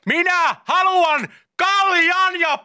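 very high, very slow bellowed demand; shouting at foreigners. Pretend. Host mocks shouting at foreigners abroad, slow bellow. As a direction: shout each word slowly as if to a foreigner. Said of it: "huutamalla niinkö tehdään ulkomaillekin".

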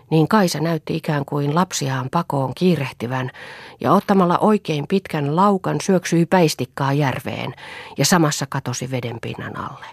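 usual speaking voice

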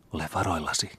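soft, hushed warning; a sister warns under her breath; whispered warning. A narrator's reading. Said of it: "kuiskasi sisar".

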